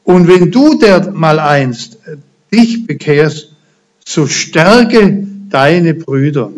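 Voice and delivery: slow and personal. Acted. Jesus promising Peter, slow and earnest. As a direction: speak it slowly, as a personal promise. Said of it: "Und jetzt wird's ganz persönlich".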